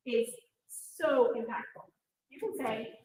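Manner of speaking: ordinary delivery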